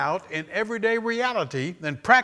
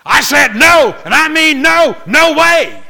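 hammered shout, far higher and much louder; emphatic refusal; 13 semitones higher, 14 dB louder than usual. Acted. Parent refusing a child's tenth request, emphatic shout. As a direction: thunder it like a parent at the end of their rope. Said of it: "That's emphatic".

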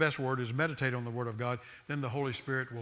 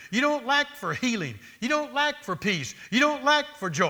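higher, louder, slow insistent preaching; preaching rebuke; 10 semitones higher, 6 dB louder than usual. Retold or imitated. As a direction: drive each "lack" home to the doubter. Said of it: "I said".

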